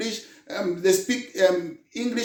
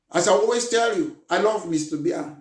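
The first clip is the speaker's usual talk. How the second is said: normal talking voice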